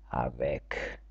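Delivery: "avec" ending in a k sound, softly; final c not as s. Shown as a demonstration. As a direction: say "avec" low and soft, closing on k. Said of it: "С на кінці не читається як С".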